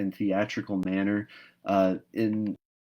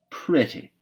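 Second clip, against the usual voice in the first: a scornful, dismissive echo of the word, higher and louder; sniffy; 6 semitones higher, 4 dB louder than usual. Acted. Marilla sniffing at Anne's wish for pretty dresses, scornful. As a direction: toss the word back with a sniff. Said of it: "Marilla sniffed".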